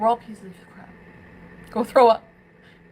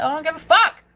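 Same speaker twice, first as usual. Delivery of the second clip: real angry outburst, much louder and higher; defiant shout; 4 semitones higher, 11 dB louder than usual. Real, not acted. Defying anyone who hates her for loving him, shouting. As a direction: shout it defiantly, hitting the last word hard.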